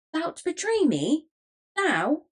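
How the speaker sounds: high, swooping incredulous question; outraged disbelief. A narrator's reading. Hobbs turns on the boy who threatens him, outraged. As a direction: pitch leaps up in affronted shock.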